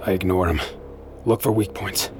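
normal talking voice

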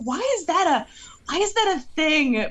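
mock bafflement. Podcaster retelling own reaction to the movie, mock baffled.

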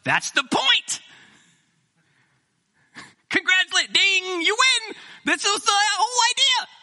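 loud, high, triumphant preaching; fired-up retelling. Retold or imitated. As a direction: belt out the winning answer like a game show host. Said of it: "My response was".

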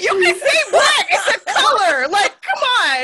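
loud, high, laughing exasperation; mock-outraged comeback; exasperated retort. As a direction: plead loudly through laughter, drawing out the end. Acted exasperated reply to hesitant people.

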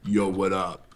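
low gangster greeting; [name] gone gangster. Retold or imitated. Calling back in a quiet room, low gangster voice. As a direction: drop into a laid-back gangster voice. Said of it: "Did he just go gangster on me".

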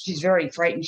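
normal talking voice

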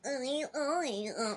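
high, slow cooing at the dog; dumb dog voice. Shown as a demonstration. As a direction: coo like talking to a puppy. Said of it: "we start talking in our dumb dog voice".

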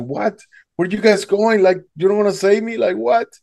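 high, incredulous cries; can't believe they're leaving him; shocked disbelief. Retold or imitated. Swimmer calls after rescuers turning back, shocked and high.

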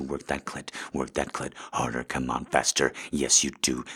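normal talking voice

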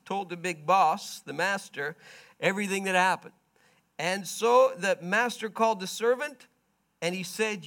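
ordinary talk

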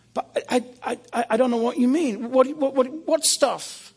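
high, hurried, tripping over words; panicked stammer. Retold or imitated. Graham caught out by Jesus, high panicked stammer. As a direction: stammer in alarm.

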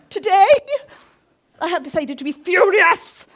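loud, high hammed-up fury; hammed-up furious vow; mock fury. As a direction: declare fury like a melodrama. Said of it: "we wake up and go".

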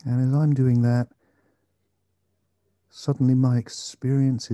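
slow, calm meditation guide; guided meditation. Acted. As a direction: gently guide attention to the breath.

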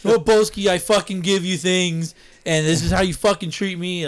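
hammed-up betrayed rant; mock outrage. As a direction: scold him in pretend fury. Mock quarrel with Boski.

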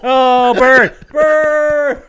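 loud, high teasing "burn!"; gleeful shout. Real, not acted. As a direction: crow it like you caught him out. Teasing a friend after a joke.

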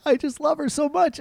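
high, wavering voice of a wife on the verge of tears; imitating tearful wife. Wife choking up over her dog, imitated high and tearful. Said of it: "starts crying".

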